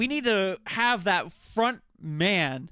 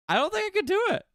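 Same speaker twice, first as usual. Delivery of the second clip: high-pitched protest; 12 semitones higher, much faster than usual. Real, not acted. Streamer insisting he can't scam, high-pitched protest. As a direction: voice jumping up high. Protesting his honesty, voice jumps.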